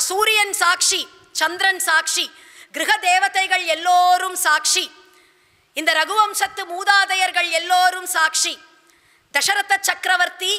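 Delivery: high-pitched, emphatic invocation of witnesses; acted queen's ringing declaration; proclaiming. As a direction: call each witness out like an oath. Queen calls gods as witnesses.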